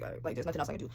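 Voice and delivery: half-whispered, resigned; resigned mutter. Retold or imitated. To herself after the officer takes her things, resigned mutter. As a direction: breathe it out like giving up. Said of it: "So I was just like".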